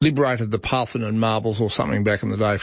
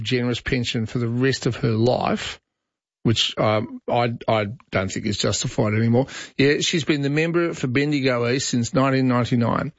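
normal talking voice

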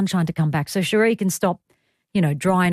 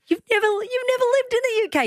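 very high, incredulous squeal of teasing; mock indignation; faster than usual. Host teasing studio sidekick about the UK, mock indignation. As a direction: squeak it in disbelief, pitched right up.

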